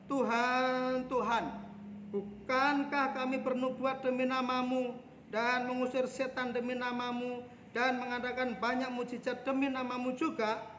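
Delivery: pleading call to God; crying out. Acted. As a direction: cry out to the Lord as a desperate claimant. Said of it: "banyak orang akan berseru kepadaku".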